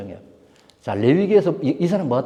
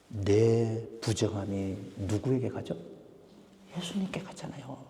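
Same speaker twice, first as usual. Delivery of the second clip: much softer, slow and grave; hushed; 4 semitones lower, 11 dB quieter, slower than usual. Real, not acted. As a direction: lean in and lower the voice. Hushed dramatic questioning. (낮고 조용하게)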